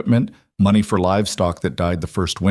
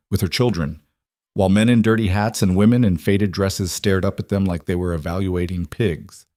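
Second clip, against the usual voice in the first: ordinary delivery, nothing marked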